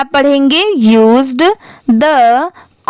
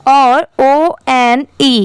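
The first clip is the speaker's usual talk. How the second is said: slow spelling out; much slower than usual. Shown as a demonstration. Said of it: "ये क्या होता है".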